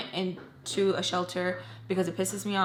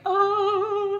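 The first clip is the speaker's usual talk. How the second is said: high, loud drawn-out "ohhh"; mad "oh"; 12 semitones higher, 6 dB louder than usual. Retold or imitated. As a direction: let out a long, high mad howl. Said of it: "like, mad".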